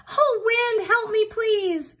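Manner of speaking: very high, loud plea, slowing; calling out for help. Acted. Rooster flung from window cries to the wind, high and loud. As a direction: call to the wind while tumbling through the air. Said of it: "he called out".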